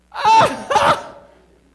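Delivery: high, loud burst of laughter; whooping laugh of joy; shrieking joyful laugh. Retold or imitated. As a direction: explode into a delighted laugh. Hearing car is paid off.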